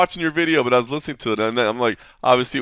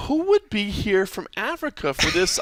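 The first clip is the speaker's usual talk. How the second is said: high, puzzled wondering aloud; high puzzled question at a surprise accent; 9 semitones higher than usual. Retold or imitated. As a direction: wonder who on earth that is. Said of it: "and I'm like".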